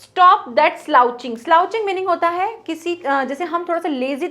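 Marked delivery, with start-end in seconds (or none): 0.0-1.4 slow poem reading. Shown as a demonstration. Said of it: "कैसे बैठना है आपको?".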